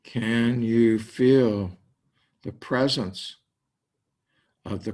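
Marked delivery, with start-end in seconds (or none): none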